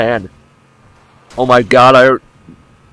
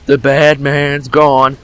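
slow, lightly put-on comforting voice; calm a frightened child, slowly; mock soothing; 3 semitones higher, much slower than usual. Soothing after the fight.

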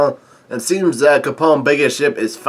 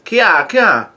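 high-pitched imitation of a giggling baby; baby's laughing squeal; 6 semitones higher than usual. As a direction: squeal it like a playful infant. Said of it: "Why have you started laughing".

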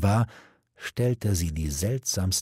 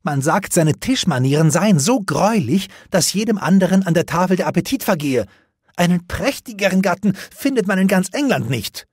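high, loud scornful princess; a defiant princess mocking her suitor; 11 semitones higher, 5 dB louder than usual. Guinevere voiced, scornful.

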